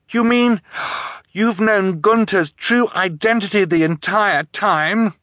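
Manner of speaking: high, loud, appalled question; horrified. A narrator's reading. Orphan realising her guardian knew all along, horrified. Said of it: "Violet asked in horror".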